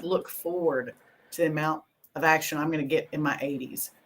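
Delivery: ordinary talk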